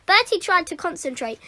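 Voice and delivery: ordinary delivery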